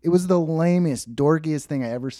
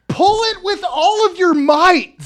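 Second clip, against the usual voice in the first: high, loud, slow goofy command; goofy plea; 10 dB louder than usual. Retold or imitated. Hand stuck in magnets, goofy heroic plea to coworker. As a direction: beg it in a silly heroic voice. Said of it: "the way I said ... so goofy".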